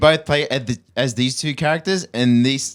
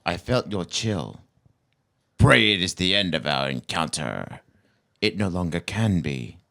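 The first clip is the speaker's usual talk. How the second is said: dark game character; 6 semitones lower than usual. Acted. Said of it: "quote from a game".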